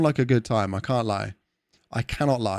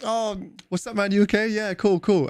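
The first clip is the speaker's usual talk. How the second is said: breezy passing greeting; 9 semitones higher than usual. Retold or imitated. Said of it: "You'll just be like".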